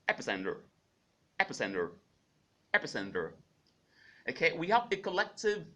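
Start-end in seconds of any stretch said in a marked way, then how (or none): 0.1-3.3 epicenter with a flapped d, General American style; General American pronunciation. Shown as a demonstration. Teacher demonstrating General American epicenter. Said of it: "In G A pronunciation, it is pronounced".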